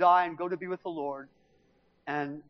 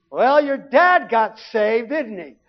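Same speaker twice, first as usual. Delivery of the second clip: knowing remark; 4 semitones higher, 8 dB louder, slower than usual. Retold or imitated. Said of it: "looks at me and goes".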